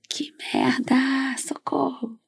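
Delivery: whispered, voice cracking away; croaky whisper, voice failing; voice giving out. Real, not acted. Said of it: "agora eu perdi a voz".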